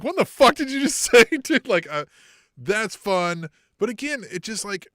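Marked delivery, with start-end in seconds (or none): 0.0-1.7 mock outraged yell. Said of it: "they'll be like".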